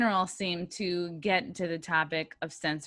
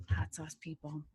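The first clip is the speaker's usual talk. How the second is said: much softer, under the breath; quiet mock aside; 3 semitones lower, 9 dB quieter than usual. Host joking about her sponsor, quiet aside. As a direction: drop it to a low-volume aside.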